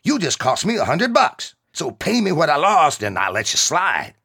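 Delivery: high, loud furious demand; angry demand. Acted. Angry soldier demanding the kid pay up. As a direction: shout the terms at him.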